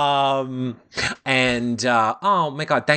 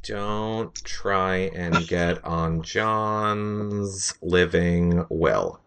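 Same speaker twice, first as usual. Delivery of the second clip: deadpan low tease; 6 semitones lower, 4 dB quieter, much slower than usual. Real, not acted. Low, slow teasing line.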